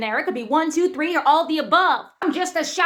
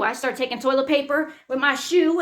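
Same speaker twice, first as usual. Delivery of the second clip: ordinary talk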